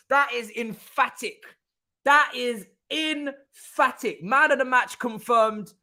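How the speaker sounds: slow, high, weighted verdict; emphatic amazement. Real, not acted. Fan passing verdict on a goal, slow emphatic awe. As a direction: draw each word out high for emphasis. Pronouncing on the goal just scored.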